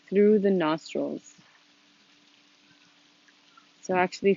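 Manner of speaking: calm, unhurried instruction with long pauses; gently leading a seated meditation, leaving space between phrases; guided meditation. Real, not acted. Leads a meditation.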